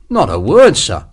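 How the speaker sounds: mock-innocent assurance; pretended shock. A narrator's reading. Soldier teasing his officer about a secret, mock shocked. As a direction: promise it with feigned shock. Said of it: "Sharp pretended to be shocked".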